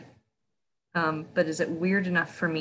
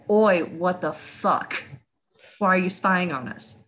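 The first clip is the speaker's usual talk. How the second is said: mock-indignant demand; slow, drawn-out opening, then a challenge; mildly angry confrontation; 4 dB louder, slower than usual. Player confronting a spying raccoon, mildly indignant.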